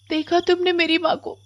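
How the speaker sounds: put-on high-pitched child's complaint; plaintive little girl. Hungry Komal tells the witch her mother locked her out, plaintive. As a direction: complain in a small, high girl's voice.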